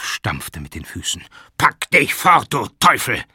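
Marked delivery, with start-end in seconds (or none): 1.6-3.2 loud, high, distorted shout; furious curse. A narrator's reading. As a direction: drive him out like a devil. Jakow stamps and curses. (wütend fluchend schreien)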